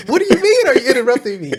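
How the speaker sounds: high-pitched, incredulous comeback, half laughing; mock-outraged squeal of a question; mock indignation. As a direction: throw it back in disbelief, voice leaping up. Mock indignant reply to interruption.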